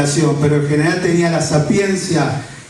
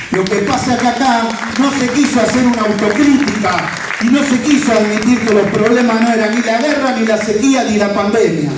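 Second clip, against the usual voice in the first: rousing political speech; 5 semitones higher than usual. Real, not acted. Rally speech climbing.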